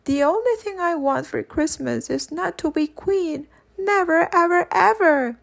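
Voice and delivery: put-on stamp it out like a stubborn child; defiant little girl. A narrator's reading. Young Victoria insists, never ever.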